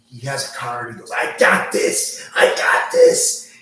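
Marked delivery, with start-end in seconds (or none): 1.1-3.5 strained, drawn-out dwarf yelling high; a wounded, furious dwarf forcing the words out; angry dwarf. Acted. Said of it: "he's angry".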